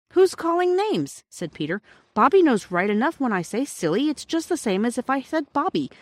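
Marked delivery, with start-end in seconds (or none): 0.1-1.2 high, loud, drawn-out challenge; offended child's high-pitched retort; indignant boy. A narrator's reading. Children bickering before bed. 2.1-5.9 put-on high, insistent child's protest; squabbling boy justifying himself; defensive boy. A narrator's reading.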